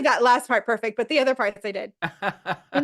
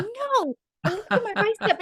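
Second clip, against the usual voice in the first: very high, mock-offended; indignant Chandler; 8 semitones higher than usual. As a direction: protest in a squeaky voice.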